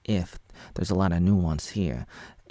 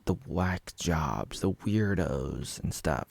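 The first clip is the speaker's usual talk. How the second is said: slow, soft and low, sneering the labels; muttered mockery; 3 semitones lower, 6 dB quieter, much slower than usual. Vlogger mocking the critic's labels, slow soft sneer. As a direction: drop into a slow sneering undertone.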